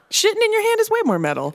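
excited insistence. Real, not acted. Insisting loudly about metal.

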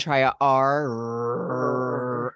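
low rumbling voice. Shown as a demonstration. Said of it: "honey rumble sound".

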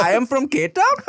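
high, lightly voiced unsure child; confused kid voice. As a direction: answer like a puzzled little kid. Mocking a kid's confused answer.

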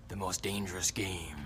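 low, hushed movie-trailer line; dramatic trailer voice. Pretend. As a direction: drop low like an ominous film narrator. Dramatic aside, far lower, soft.

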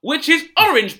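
high, loud mock outrage; hammed-up indignant Gwyneth; indignant high rant. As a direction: push it up and loud in defense. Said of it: "Oh, Dane".

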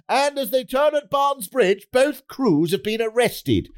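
high-pitched boat race commentator; sports commentary. Acted. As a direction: call it like live race commentary, voice lifted high. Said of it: "So the commentary will go".

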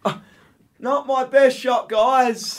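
put-on high, slow small-kid voice; imitated little boy. Fallen boy looks up at watchers, high slow child voice. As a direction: play a dazed child after a tumble, slowly. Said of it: "looks up at us and goes".

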